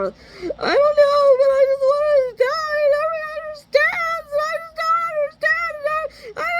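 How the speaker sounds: mother mocking her son's crying whine; mock whining sob. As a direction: mimic him wailing that he wanted to die. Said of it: "he whined".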